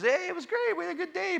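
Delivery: high, chirpy imitation of a wife; light high-voiced wife impersonation; wife on phone. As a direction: pitch it way up, breezy phone report.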